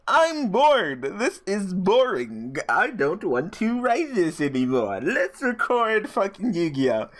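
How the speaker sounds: high, slow, whiny complaining; whiny bored. Retold or imitated. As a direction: whine like a bored kid stuck on homework. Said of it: "and I was like".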